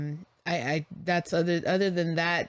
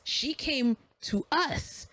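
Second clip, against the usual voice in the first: heated retort; 7 semitones higher than usual. Retold or imitated. Arguing with the stranger at the curb, heated and raised. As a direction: insist he back off.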